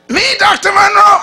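high, loud, eager call from the back of the room; excited calling out. Retold or imitated. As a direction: call out his name, thrilled to be picked. Lady calls out from the back.

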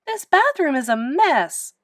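exasperated wail, lightly acted; moaning complaint. A narrator's reading. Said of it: "moaned Lewis's mother".

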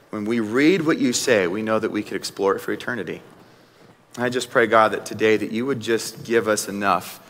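soft, low, hushed prayer. Real, not acted. Closing prayer.

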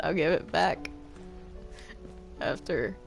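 ordinary talk, nothing marked

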